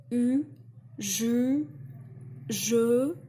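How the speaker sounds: "U, je, je" said loud and clearly French; French sounds shown. Shown as a demonstration. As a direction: belt out the French u in "je". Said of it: "They don't do the".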